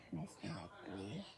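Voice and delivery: low, slow, sad question; a lightly voiced sad librarian; sad, quiet voice. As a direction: ask it with no energy left. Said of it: "in her new, sad, quiet voice".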